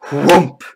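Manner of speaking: breathy falling whoomp; imitated thud. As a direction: make the thump of a limp body landing. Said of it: "it just kind of".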